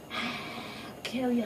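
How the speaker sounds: very slow, soft whisper; slow whispered villain line; whispered threat. Retold or imitated. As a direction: drag it out as a hiss of menace. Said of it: "she's like".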